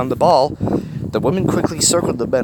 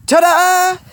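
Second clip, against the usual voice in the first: loud, high showy reveal; excited; 7 dB louder than usual. A narrator's reading. Woman revealing the pink metal ball, excited. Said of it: "the woman declared excitedly".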